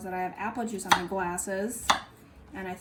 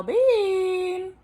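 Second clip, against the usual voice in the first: drawn-out call; 11 semitones higher, 6 dB louder than usual. Real, not acted. Calling the dog slowly.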